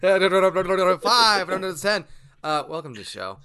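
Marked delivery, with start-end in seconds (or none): 0.0-2.0 auctioneer voice. Pretend. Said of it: "you sound like an auctioneer".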